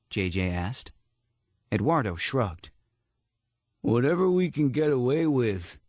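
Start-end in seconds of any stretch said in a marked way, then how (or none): 3.8-5.8 high, lightly voiced tough guy; a hint of a cocky street kid; tough teen voice. A narrator's reading.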